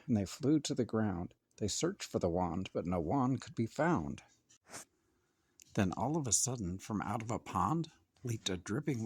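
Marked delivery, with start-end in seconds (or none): none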